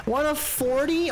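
high, slow disbelieving question; can't believe the number; high incredulous gasp of a question. Real, not acted. Discovers forty collectibles.

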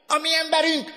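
very high, loud howl; howling shout. A narrator's reading. Leader to the members below, howling shout. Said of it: "süvöltötte a lenti tagoknak".

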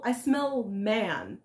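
very slow, each word drawn out; slow menacing drawl. Acted. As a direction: drag it out like a hungry devil. Devil sniffs for intruder.

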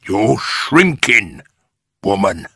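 gloating husband; Mr Twit. A narrator's reading. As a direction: draw it out to torment her. Mister Twit taunts his wife.

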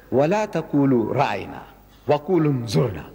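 fast Arabic recitation of the verse; Quran recitation. Acted. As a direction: recite the verse swiftly and evenly.

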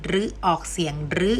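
"รึ" with a short close back unrounded vowel; ฤ as RUE. Shown as a demonstration. Teacher showing the first of three sounds, short รึ. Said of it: "จะแบ่งออกเป็นสามเสียง".

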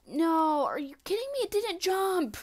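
high, loud cry of disbelief; frustrated yell. Real, not acted. Gamer shouting when his character won't jump. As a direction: yell it at the screen.

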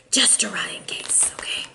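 hushed whisper. Real, not acted. Reader whispering savings advice to Pisces viewers. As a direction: whisper it like a secret.

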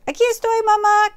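high, loud calling out; high bird voice shouting. Acted. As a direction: call up to the plane as loud as you can. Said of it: "gritó".

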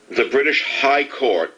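slow down and punch High in the British High Court; sarcastic weight on High; stress on HIGH. Shown as a demonstration. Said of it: "emphasis on the middle word".

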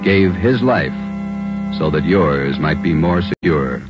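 ordinary delivery

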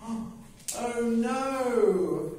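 high, put-on dismayed oh no; horrified oh no. Bird in shock at the dead sausage, high dismayed cry. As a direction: let it drop out in horror.

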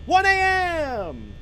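high, loud, drawn-out holler; mock-dramatic yell. Real, not acted. Player calling out the game clock, hollered.

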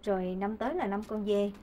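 soft, low prayer voice; praying. Acted. Wife praying to the Kitchen God for her household, softly praying. Prays at the altar.

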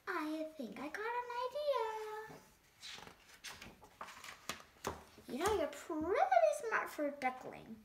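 lively picture-book read-aloud; animated storytime reading; reading to children. A narrator's reading. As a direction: read it to a room of kids.

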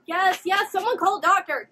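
high, loud urgent call. Real, not acted. Viewer wanting a doctor called, urgent.

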